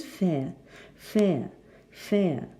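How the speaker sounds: "fair" with a silent R; non-rhotic "fair", ending on the vowel; transcription pronunciation. Shown as a demonstration. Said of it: "So the phonetic transcription is".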